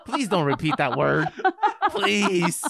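high, loud begging through laughter; laughing plea. Real, not acted. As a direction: beg helplessly while cracking up. Laughing, begging co-host to stop.

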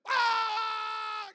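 very loud, long high scream; sustained full-force shriek. Retold or imitated. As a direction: imitate a kid waking straight into terror. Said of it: "starts screaming again".

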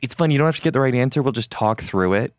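high, eager recruiter pitch; relentless hard-sell friendliness. Retold or imitated. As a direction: gush like a pushy recruiter. Recruiter selling the coding test.

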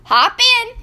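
high, loud cheerful farmer; cheery farmer. A narrator's reading. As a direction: call it out brightly. Farmer voiced in story.

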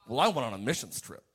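lightly voiced boasting believer; smug religious person. Preacher mocking a self-righteous believer, lightly smug. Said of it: "someone that just has an attitude".

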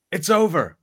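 loud, high mock announcement; imitated big-screen exclamation; triumphant movie quote. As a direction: call it out like a closing line. Quotes film's closing shouted line.

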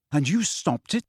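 mild amazement, impressed; amazed, impressed. A narrator's reading. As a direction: ask with understated wonder. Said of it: "amazed and impressed".

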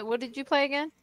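ordinary delivery

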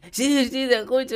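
high-pitched, overeager reply; delayed reporter. Retold or imitated. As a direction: answer late and too keen. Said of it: "espera tres segundos y dice".

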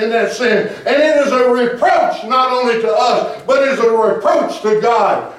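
driving, high-pitched preacher delivery; full-force preaching. Real, not acted. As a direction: press it on the congregation without letting up.

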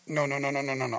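very soft, low string of noes; retold horror under the breath; stunned refusal. Mom to herself after looking down, hushed dread. Mom dumbstruck at what she saw.

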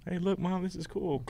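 high, bright call to mom; mock kid shouting to mom; excited kid. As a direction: squeal it like a thrilled kid. Kid voicing swimmer delight.

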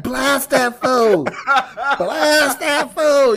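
high, drawn-out put-on voice; mock bedroom command. Woman telling him what to do in bed, mock commanding. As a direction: stretch each word, hammed up. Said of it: "that's what she telling you to do".

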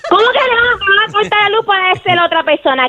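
furious complaint to the phone line; angry. Real, not acted. Caller furious at prank menu.

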